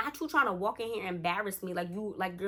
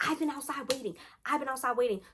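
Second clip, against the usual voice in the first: higher-pitched mock complaining; imitated complaint; 5 semitones higher, faster than usual. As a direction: act out her put-out complaint. Storyteller voices the woman complaining.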